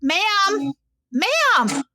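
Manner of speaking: mock call for attention. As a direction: call across to the old lady. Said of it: "and the woman goes".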